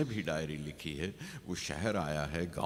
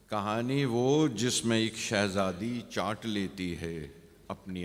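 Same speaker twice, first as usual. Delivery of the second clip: poem recitation; 2 semitones higher, 4 dB louder than usual. Acted. Poet asks after an unfinished tale, measured recitation. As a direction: recite it measured, then raise it for the second reading.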